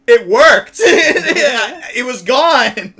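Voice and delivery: high, loud laughing. Real, not acted. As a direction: crack up while saying it. Laughing while quoting.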